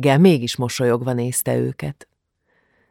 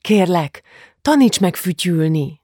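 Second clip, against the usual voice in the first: put-on higher, pleading little-girl voice, a touch winded; slightly panting; 5 semitones higher than usual. A narrator's reading. Little daughter begs father for whistling lessons, slightly panting. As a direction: plead lightly, a little out of breath. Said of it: "szólította meg Julcsi kissé lihegve".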